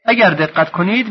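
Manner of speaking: normal talking voice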